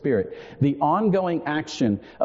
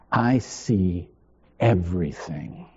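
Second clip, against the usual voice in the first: deep, measured I see everything; low confession; 8 semitones lower than usual. Shown as a demonstration. To the congregation, he declares it low and sure. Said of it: "that was my confession".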